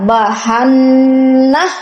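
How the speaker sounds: slow chanted ইন্না Samuda with ghunna; Quran recitation. Acted. Recites verse slowly. (ধীর সুরেলা তিলাওয়াত)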